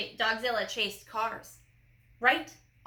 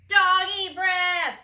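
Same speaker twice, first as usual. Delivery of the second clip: higher, louder, slow screamed cry; 8 semitones higher, 8 dB louder, much slower than usual. A narrator's reading. Mouse soldiers flee the dog's breath, slow scream. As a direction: scream it out in disgust. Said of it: "screamed the soldiers".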